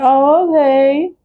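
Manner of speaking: sing-song mock agreement; mock naive self. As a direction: stretch it out like a naive yes.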